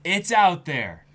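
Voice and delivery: high, loud and slow reassurance; excited gushing. Real, not acted. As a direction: gush about the good news. Gushing paraphrase.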